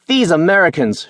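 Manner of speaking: high, scornful hiss. A narrator's reading. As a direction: spit the words out with contempt. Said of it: "she hissed".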